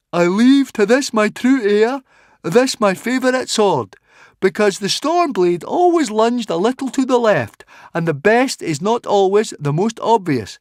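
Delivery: high, loud, declaimed reading; Fishlegs reading aloud. A narrator's reading. Fishlegs reads the will to the crowd, high and loud. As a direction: read it out to all, pitched high. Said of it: "read Fishlegs".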